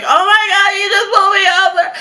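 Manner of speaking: very high, loud tearful squeal; hysterical panic. Retold or imitated. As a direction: wail it like a mock crying freak-out. Panicked message to her friend.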